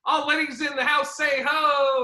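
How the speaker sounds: high-pitched party-host chant; crowd hype. Retold or imitated. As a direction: work the room for a call-back. Imitates comic hyping the crowd.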